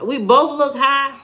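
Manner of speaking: offended, voice lifting into a question; incredulous echo. Real, not acted. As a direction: echo the accusation in disbelief. Echoes the comment in disbelief.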